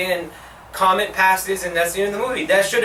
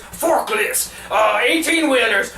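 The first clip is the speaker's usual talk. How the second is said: higher-pitched hard-sell parody; mock commercial pitchman; 5 semitones higher than usual. Mocking an old truck video commercial, overeager pitch. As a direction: pitch it like a cheesy TV ad.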